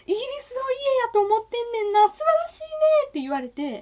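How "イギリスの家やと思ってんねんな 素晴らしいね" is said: much higher, delighted exclamation of a relative; excited quote. Retold or imitated. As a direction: gush over it in a high, thrilled voice. 大叔父の感激した言葉を再現. (ずっと高い声で感激して)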